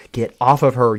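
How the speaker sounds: loud desperate yell. Acted. Luna yelling at Terminal to release Harmonia, loud yell. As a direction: shout it to pull him off. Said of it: "Luna yells".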